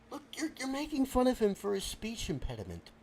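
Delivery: ordinary talk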